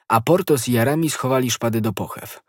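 usual speaking voice

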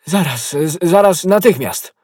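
high, loud, hurried-sounding assent; eager reply. A narrator's reading. Athos promises to open the door, eagerly.

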